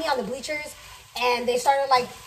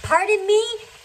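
high, loud, offended disbelief; indignant pardon me; 8 semitones higher, 6 dB louder, much slower than usual. Retold or imitated. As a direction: act shocked and insulted, half-shouting. After being hit with the door.

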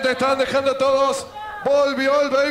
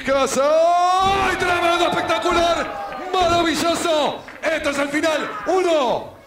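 excited shout; 4 semitones higher than usual. Acted. Near-fall climax.

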